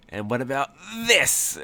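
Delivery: dramatic reveal, rising high on THIS; overly dramatic. Acted. Boss waving evidence in the leprechaun's face, hammed-up drama. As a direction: draw out the last word with a theatrical flourish. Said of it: "trying to be really, like, dramatic".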